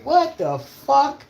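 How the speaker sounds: high, slow, drawn-out what the fuck; stunned disbelief. Retold or imitated. Reacting to collapsing view counts, drawn-out disbelief. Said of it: "I'm like".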